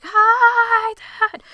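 very high, loud, drawn-out holler; exaggerated, stretched-out calling yell; shouted greeting. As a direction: holler it so he hears from the field. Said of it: "I can't hear you".

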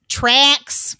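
slow, drawn-out stab at an Australian accent; attempted Australian accent. Shown as a demonstration. Host announcing the film Tracks, badly attempted Australian accent. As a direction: stretch it in a shaky amateur Australian accent. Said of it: "I can't do an Australian accent".

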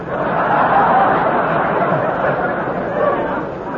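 high airy burst of laughter; hearty laugh. Real, not acted. Host laughs after punchline.

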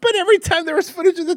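can barely contain the laughter; excited, high-pitched laughing retelling. Real, not acted. Excited retelling of absurd scene.

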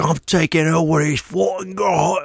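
high, badly faked British accent; attempted British accent. Cousin claiming the garage, in a fake British accent. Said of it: "he's British".

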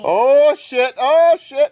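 high, loud whooping reaction; loud hyped-up exclamation; excited outburst. Real, not acted. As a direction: crow over the score. Reacting to the score call.

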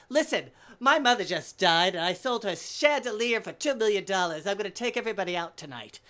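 loud, high, showy bragging; flamboyant old man. Retold or imitated. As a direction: announce it grandly as an extravagant old gentleman. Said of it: "so extravagant and flamboyant".

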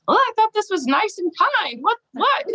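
high, squeaky mock shock rising to disbelief; mock shocked reader. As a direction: play a startled prim reader. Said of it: "start reading it and be like".

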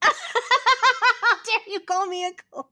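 high, slow, laughing through the words; can't keep it together. Pretend. Repeating the line while laughing.

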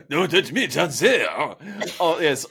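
high, loud hammed-up faux French; Pepe Le Pew accent. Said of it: "talk like Pepe Le Pew".

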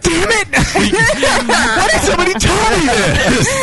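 high laughing exclamation. Real, not acted. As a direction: crack up while complaining. Laughing at own failure.

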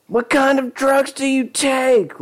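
high, slow shriek; screaming. Acted. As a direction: scream it at him. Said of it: "Lisa screamed at him".

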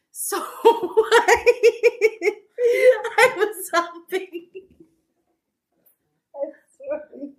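high, helpless laughter, near tears; laughing so hard it turns to crying. Real, not acted. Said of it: "Kat is laughing so hard right now".

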